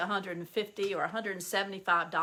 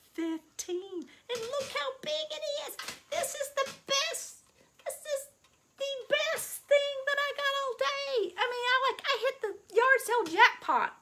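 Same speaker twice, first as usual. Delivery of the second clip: much higher, gushing delight; excited squeal. Real, not acted. Squealing to viewers about the yard sale jackpot. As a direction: squeal with glee over the find. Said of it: "So I'm excited about that".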